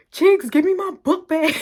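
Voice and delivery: high, loud call to a friend; acted urgent shout. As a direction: re-enact yelling across the bus. Calling to friend mid-fight.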